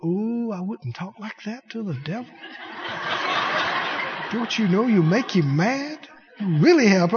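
hushed mock fretting busybody; a hint of a nervous scold; timid church gossip. Preacher mimicking timid churchgoers, hushed mock fretting. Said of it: "some people go".

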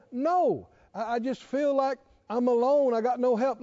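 ordinary talk

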